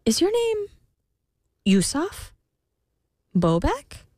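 rising, hopeful guessing; hopeful queen. Acted.

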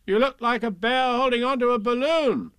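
put-on high, frank child's answer; young boy's voice. A narrator's reading. Boy tells Pooh how he looks, high child voice. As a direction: call it up in a boyish pitch.

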